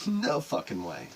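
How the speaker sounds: ordinary talk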